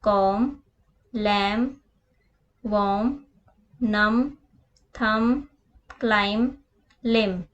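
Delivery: कॉम, थम, क्लाइम, लिम said ending in m with no b; each word alone, the final b dropped; silent b words. Shown as a demonstration. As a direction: model the words for pupils without the b. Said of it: "letter silent रहेगा वो है बी".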